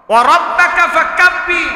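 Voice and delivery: high, loud proclamation; emphatic recital. Shown as a demonstration. Preacher repeating the verse to the crowd, ringing out.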